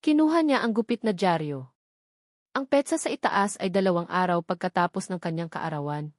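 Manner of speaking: ordinary delivery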